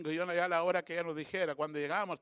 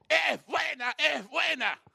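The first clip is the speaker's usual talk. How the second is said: high, louder imitation of a little old aunt; tía Tuta; 10 semitones higher, 7 dB louder, much slower than usual. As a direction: insist in a raised, put-on voice. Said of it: "Como la tía Tuta, así". (imitación de una viejita insistente, en voz aguda)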